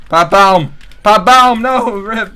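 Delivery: loud, high yelling of pipe bomb; excited shouting. Real, not acted. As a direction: shout it over the horde. Shouting as zombies swarm.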